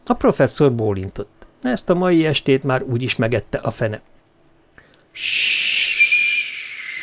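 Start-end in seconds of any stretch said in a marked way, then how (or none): 5.1-7.0 drawn-out unvoiced shushing; rustling hiss. A narrator's reading. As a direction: hiss like wind through the bushes. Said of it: "susogták a boróka bokrok ágai".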